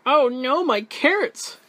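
high, loud rabbit outcry; dismayed cry. A narrator's reading. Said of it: "cried Rabbit".

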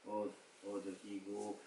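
very soft murmured reading; reading to himself. Retold or imitated. Guru reading the note on his lap, murmuring softly. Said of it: "he's reading loud, he's himself reading".

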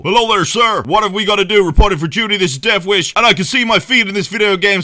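put-on American soldier accent; hammed-up American accent. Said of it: "How's my American accent?".

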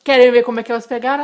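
loud, fast teaser; clickbait video narrator. Acted.

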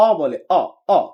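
British "অ", a rounded AW; British accent. Shown as a demonstration. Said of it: "ব্রিটিশরা যেখানে". (British উচ্চারণে)

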